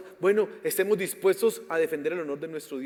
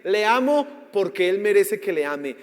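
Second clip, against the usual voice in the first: loud, high preaching at full force; fiery preaching; 5 semitones higher, 11 dB louder than usual. Real, not acted. Preacher challenging the congregation, loud, at full force. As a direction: thunder it out from the pulpit.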